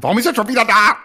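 high, loud indignant squeal; mock the outraged commenters; outraged Twitter user. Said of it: "wie sich alle aufregen".

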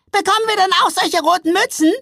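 very high, loud small troll; eager little troll. A narrator's reading. Little troll asking for red hats too, high and eager. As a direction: pipe up like an excited troll child.

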